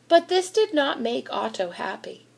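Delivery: ordinary delivery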